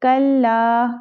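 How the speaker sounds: ordinary talk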